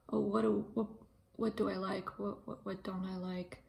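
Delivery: ordinary delivery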